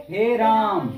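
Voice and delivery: हे राम with both words drawn out long; prolonged call. Shown as a demonstration. Teacher demonstrating the long vowel to class, drawn out. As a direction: stretch हे and राम over three beats. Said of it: "हे राम तो तीन मात्रा का समय लगता है".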